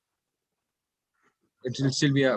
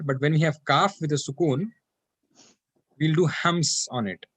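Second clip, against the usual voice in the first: ordinary delivery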